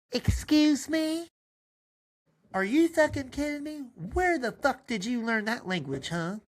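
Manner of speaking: mama outrage. Acted. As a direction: gasp at the baby's language. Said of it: "Diesel's supposed to say that anyway".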